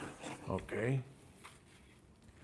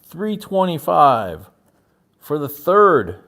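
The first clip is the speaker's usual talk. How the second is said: auctioneer call; 5 semitones higher, 8 dB louder than usual. Acted. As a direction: call the bid to the room. Auctioneer closing the sale.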